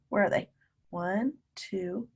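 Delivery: normal talking voice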